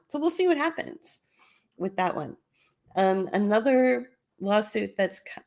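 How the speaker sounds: ordinary talk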